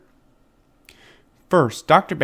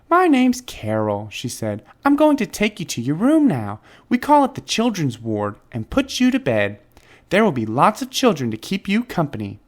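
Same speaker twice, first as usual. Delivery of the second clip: high, sweet nurse voice; chirpy and kind like a young nurse; 6 semitones higher than usual. Acted. Nurse telling George about his name bracelet, high and sweet.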